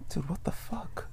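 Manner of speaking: hushed, breathy disbelief; under the breath, appalled; stunned whisper. Real, not acted. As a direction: whisper it in shock. Stunned by a grim movie plot.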